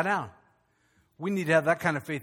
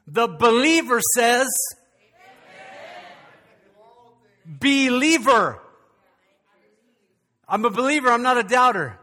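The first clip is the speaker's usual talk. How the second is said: raised, emphatic pulpit proclamation; loud, higher declaration of faith; rousing preacher; 6 semitones higher, 7 dB louder than usual. Real, not acted. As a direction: drive it home to the congregation. Preaching at full force.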